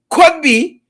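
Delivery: could pushed up sharply, be dropping away; stress on could. Shown as a demonstration. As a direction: hit the word could hard. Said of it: "Not shall be".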